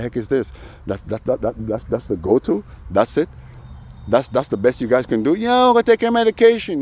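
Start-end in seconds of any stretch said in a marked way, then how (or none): 5.3-6.8 high, loud sneering taunt; squeaky mock heckler yelling. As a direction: mimic the guy jeering from his window. Mocking the taunter at the window.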